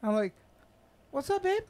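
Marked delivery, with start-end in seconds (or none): 1.1-1.6 higher, falsely innocent greeting; mock innocent. Telling how he answered his girlfriend, mock innocent. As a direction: play it cool like nothing happened.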